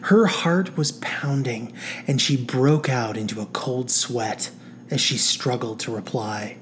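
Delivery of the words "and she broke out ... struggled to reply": plain reading voice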